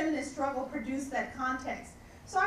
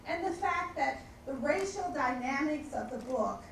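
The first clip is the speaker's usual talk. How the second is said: normal talking voice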